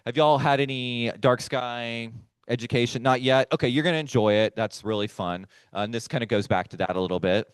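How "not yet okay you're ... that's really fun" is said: normal talking voice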